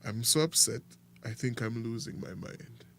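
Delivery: normal talking voice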